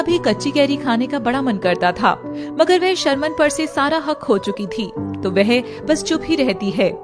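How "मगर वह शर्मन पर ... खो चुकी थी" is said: ordinary delivery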